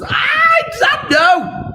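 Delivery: very high, slow, strained I don't know; high flustered squeak. Real, not acted. Teacher, caught out by friends watching, squeaks.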